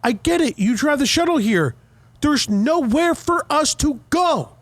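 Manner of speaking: high, exasperated retort; retold exasperation. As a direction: spell it out to the driver, slowing at the end. Retold argument with shuttle driver.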